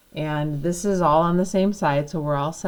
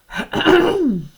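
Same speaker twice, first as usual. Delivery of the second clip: throat clearing; 13 semitones higher, 10 dB louder than usual. Real, not acted. Throat clearing mid lesson.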